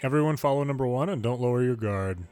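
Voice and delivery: ordinary delivery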